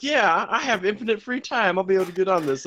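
overconfident. Retold or imitated. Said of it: "as I was like".

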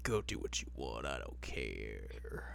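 hammed-up gloomy drone; mock brooding mumble. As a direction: drone it out like a sulking teen. Voicing sulky Koga.

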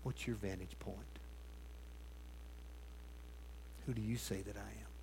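a hushed, direct challenge; quiet, searching question. Acted. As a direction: put it to them gently but squarely. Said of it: "looks them square in the eye and says".

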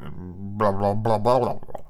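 low bubbly lip flapping; floppy-lipped blah-blah; loose-lipped jowl blabber. Shown as a demonstration. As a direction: shake the jowls through nonsense syllables. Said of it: "loosen your lips and get a jowl going".

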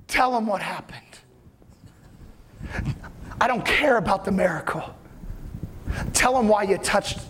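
a hint of tender Jesus speaking to her; gentle Jesus. Jesus gently tells the woman to speak, low and tender.

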